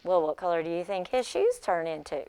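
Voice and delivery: usual speaking voice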